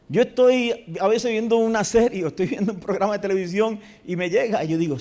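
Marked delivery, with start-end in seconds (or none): none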